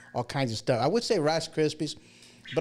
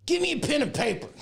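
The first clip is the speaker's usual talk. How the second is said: frantic demand; 11 semitones higher, faster than usual. Retold or imitated. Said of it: "I'm like".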